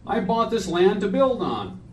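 measured poetry reading at a memorial; solemn reading. Acted. Reads his friend's poem aloud.

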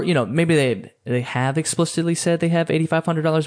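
ordinary talk, nothing marked

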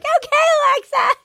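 high, whimpering mock plea; pleading with Alexa. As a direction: beg the machine to stop, near tears. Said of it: "You're like".

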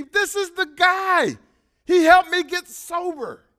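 high, loud, slow proud declaration; excited announcement. Retold or imitated. Pointing out his old sponsor to the meeting, excited and loud. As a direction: announce him to the whole room.

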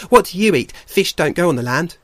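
high, loud, cheeky otter; boisterous otter. Acted. Shoreback questions the bears about food, high and boisterous. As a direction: quiz them in a loud high voice.

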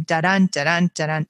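Da-dun stressed on the second beat, dun; iambic da-dun beat. Shown as a demonstration. Teacher demonstrating the iambic rhythm, beating da-dun. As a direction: tap out da-dun with weight on dun. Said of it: "that iambic rhythm".

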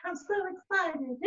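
high, drawn-out mock delight; tiny gleeful creature voice, high and slow; excited leech. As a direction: squeal slowly like a parasite finding a host. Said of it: "Like a leech".

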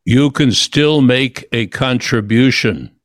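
low, slow voice from the darkness; very deep voice. Retold or imitated. Voice out of great darkness speaks to injured man, very deep. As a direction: say it deep and deliberate, slowly. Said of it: "in a very deep voice".

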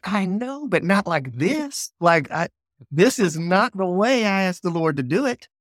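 high-pitched, upset pleading protest; exasperated protest. Retold or imitated. Telling his wife Charity how it went wrong, high exasperated protest. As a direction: voice jumps high in frustrated disbelief.

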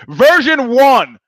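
loud, high, drawn-out title call; show announcer. Real, not acted. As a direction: belt it out slowly like a ring announcer.

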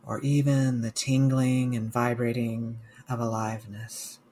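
slow, calm meditation guide with long pauses; guided meditation. Real, not acted. Mindfulness coach guiding listeners through a body scan, guided meditation. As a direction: guide listeners gently, leaving space after each phrase.

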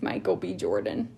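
very low, swooning name drop. Pretend.

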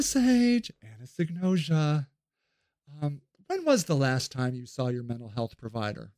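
low, soft patronizing calm; mock soothing official. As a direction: coo him toward treatment.

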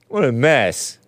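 usual speaking voice

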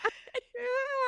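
tearful high laugh breaking into a cry. Real, not acted. Guest moved by the host's words, laughing through tears. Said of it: "Now I'm gonna cry".

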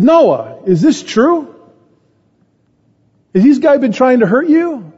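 high-pitched retold dad's disbelief; incredulous questioning. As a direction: press your son for the truth, voice lifting high. Retells dad questioning son after game.

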